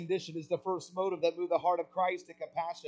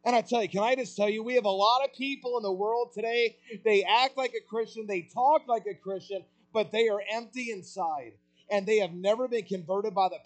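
loud, fast, high-pitched preaching push; preaching at full force; 5 semitones higher, 6 dB louder than usual. Acted. Preacher warning churchgoers, at full force. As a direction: drive it home to the congregation, building.

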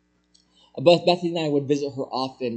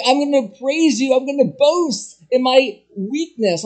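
higher and louder, eager; joyful Paul; 8 semitones higher, 5 dB louder than usual. Retold or imitated. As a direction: burst out in praise. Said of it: "He was like".

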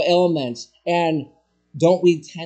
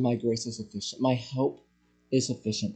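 much softer, low and gentle; tender quoted reply from God; 5 semitones lower, 8 dB quieter than usual. Acted. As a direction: say it quietly, like a reassuring father.

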